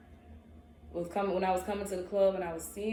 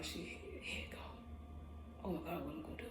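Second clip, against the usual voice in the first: breathy hushed whisper; whispered panic; 2 semitones lower, 13 dB quieter, faster than usual. Retold or imitated. Gawking at the girl, hushed whisper. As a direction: whisper it to yourself in awe.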